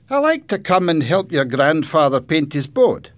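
put-on high, loud boy's voice; friendly boy character. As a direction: explain eagerly as the local boy. Boy tells about himself.

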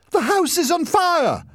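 high, loud alarm; panicked shout. A narrator's reading. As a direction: scream the warning. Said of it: "she shouted".

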